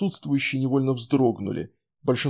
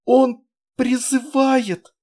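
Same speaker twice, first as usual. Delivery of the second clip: high slow terrified shriek; 12 semitones higher, 6 dB louder, much slower than usual. A narrator's reading. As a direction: shriek it out in panic. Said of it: "завизжала невеста".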